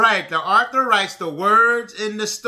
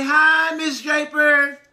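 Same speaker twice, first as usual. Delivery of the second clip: put-on child greeting author; 5 semitones higher, 3 dB louder, slower than usual. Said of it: "you can say".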